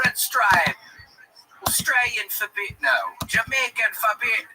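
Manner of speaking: put-on high ad-man Jamaican accent, lightly done; light Jamaican commercial voice; imitating Jamaican ad voice. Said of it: "Remember that one guy who said".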